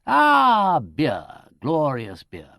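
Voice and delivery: loud, high "Ah" settling into relish; relieved. A narrator's reading. Dismissing the question as beer arrives, relieved. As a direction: greet it with a big sigh of relief. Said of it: "with an air of relief".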